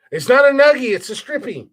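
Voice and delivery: hammed-up girlfriend scolding; imitating her yelling. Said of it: "she yells at me all the time".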